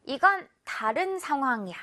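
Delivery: ordinary talk, nothing marked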